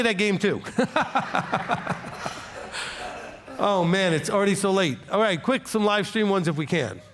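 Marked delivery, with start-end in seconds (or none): 0.7-3.6 breathy laugh. Real, not acted. Pastor laughs at own joke.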